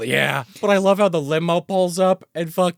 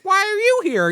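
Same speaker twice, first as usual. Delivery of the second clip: mock Rita; 3 dB louder than usual. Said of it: "She's like".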